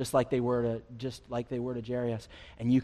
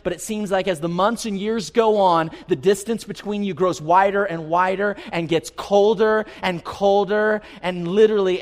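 higher and louder preaching; full-force preaching; 5 semitones higher, 8 dB louder than usual. Acted. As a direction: press it home to the congregation.

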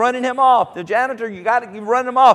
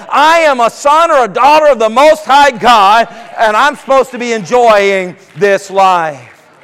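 fiery declaration; 2 semitones higher, 7 dB louder than usual. Acted. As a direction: declare it like a preacher at full force. Preaching declaration at full force.

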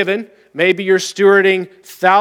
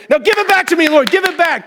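high, pushy, hammed-up insistence; mock demanding; 9 semitones higher than usual. Giver pestering the Lord for returns, hammed-up high demanding. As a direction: whine at God for the payback, pitched up.

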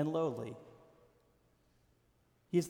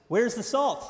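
emphatic question; 5 semitones higher, 5 dB louder than usual. Real, not acted. Rhetorical punch line in sermon.

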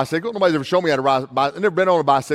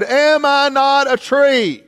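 higher, slow proud little tree; proud little sapling; 9 semitones higher, 4 dB louder, much slower than usual. Acted. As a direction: ask it like a young sapling showing off. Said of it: "He said".

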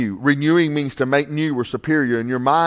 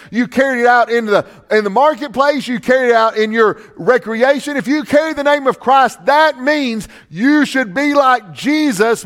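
raised, urgent pulpit voice; preaching at full force; 9 semitones higher, 5 dB louder than usual. Real, not acted. Pastor warning the church about the culture, preaching hard. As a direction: drive the warning home to the congregation. Preacher lists where name is carried.

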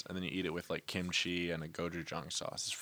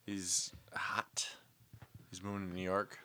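soft, unvoiced whisper; whispered aside; 2 semitones lower, 7 dB quieter than usual. Hushed aside about the friend.

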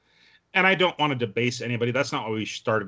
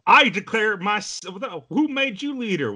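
very high, hammed-up challenge; mock indignant film quote; movie scene quarrel; 9 semitones higher, 4 dB louder than usual. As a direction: act out a squabble over who leads. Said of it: "reminds me of a scene from Oh Brother".

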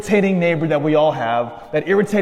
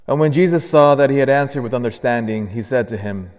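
Gospel proclamation; 5 semitones lower than usual. Acted. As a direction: read it like a liturgical proclamation.

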